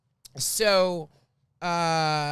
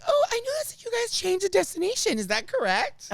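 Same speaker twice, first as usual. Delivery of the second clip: high, put-on sweet enquiry; put-on politeness; 13 semitones higher than usual. Driver to passengers who changed destination, high mock politeness. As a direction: pitch it way up, over-polite.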